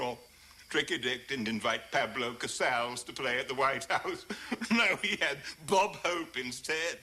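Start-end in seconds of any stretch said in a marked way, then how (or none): none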